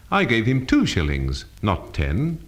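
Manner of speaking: "two" carries the main stress. Shown as a demonstration. Teacher showing emphasis changes meaning, stress on "two". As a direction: hit the word "two" to contrast it with "ten". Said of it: "different readings of a simple statement".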